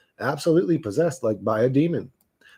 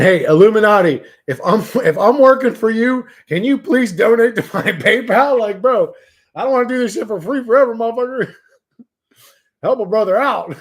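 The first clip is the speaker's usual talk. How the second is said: mock shout; 6 semitones higher, 7 dB louder than usual. Joking shout at the Illuminati.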